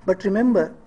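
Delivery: ordinary delivery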